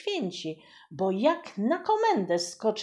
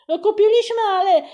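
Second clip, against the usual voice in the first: put-on flustered kitchen boy; 12 semitones higher, 12 dB louder, faster than usual. Boy flustered before voivode.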